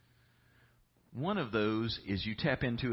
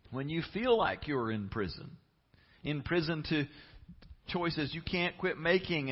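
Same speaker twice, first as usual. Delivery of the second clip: normal talking voice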